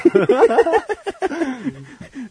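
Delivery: high-pitched laughing fit; can't stop laughing; helpless laughter. Real, not acted. Co-host's bloody tissue seen. (高い声で笑い出す)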